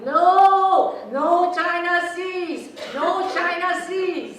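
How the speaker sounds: high, slow ghostly shout from beyond; unearthly voice. Acted. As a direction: call out like Mom's spirit from the garage. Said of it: "We hear Mom's spirit voice".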